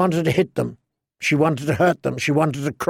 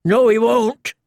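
higher, warning townsman; mild threat; 9 semitones higher, 3 dB louder than usual. Acted. A townsman warns the outlaws, higher and threatening. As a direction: warn them of the Dutchman. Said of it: "a man threatened".

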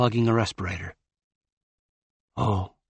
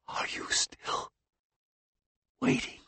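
whispering; 11 dB quieter than usual. Acted. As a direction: breathe the question out. Dying woman whispers.

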